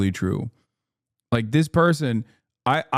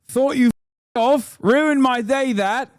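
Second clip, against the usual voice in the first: much higher, slow, exaggerated Brummie accent; mock Brummie accent, pitched way up and slow; 12 semitones higher, slower than usual. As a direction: ham up a Birmingham local, drawing the words out high. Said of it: "Look up the Broomy accent".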